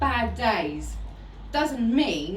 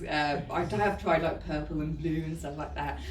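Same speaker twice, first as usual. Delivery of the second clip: ordinary talk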